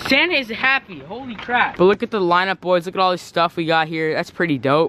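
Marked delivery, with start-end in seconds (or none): 0.1-1.8 raised happy exclamation; real glee, higher up; excited. Real, not acted. Delighted with gifts.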